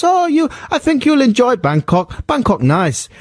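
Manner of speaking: high, mock Thai accent. Thai local answering a tourist, exaggerated mock accent. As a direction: put on a broad Thai accent, high and singsong. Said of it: "that was good, accent I did there".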